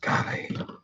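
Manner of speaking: breathy grumbling whisper. Real, not acted. Mechanic grumbling about bolts, whispered disgust. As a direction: mutter it almost silently. Muttering at square bolt users.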